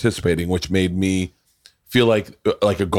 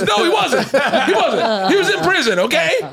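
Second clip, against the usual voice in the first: very high, indignant outburst with laughter; comic, squealed protest; mock exasperation; 3 dB louder than usual. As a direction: act out a defensive comeback, voice shooting up. Acted indignant admission to psychic.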